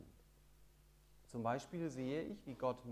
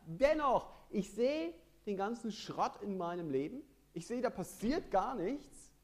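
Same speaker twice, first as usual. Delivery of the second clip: trotzig rufen; 7 semitones higher, 8 dB louder than usual. Acted. Predigt, Gläubiger ruft trotzig dennoch.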